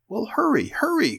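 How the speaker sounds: high-pitched, impatient urging; urgent cry. A narrator's reading. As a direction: cry out to rush a dawdling friend. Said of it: "cried Mink".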